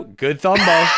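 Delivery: ordinary delivery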